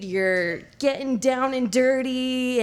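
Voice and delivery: normal talking voice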